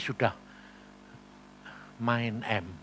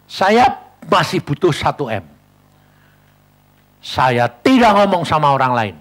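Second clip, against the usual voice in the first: preaching; 10 dB louder than usual. Acted. Preacher testifying.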